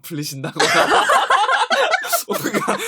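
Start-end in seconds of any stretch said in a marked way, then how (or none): 0.7-2.2 high squealing laugh; high burst of laughter. Real, not acted. Host laughing at her own joke about a cursed ball. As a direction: crack up at your own joke.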